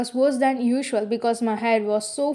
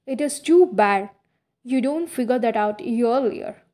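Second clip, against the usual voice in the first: ordinary delivery, nothing marked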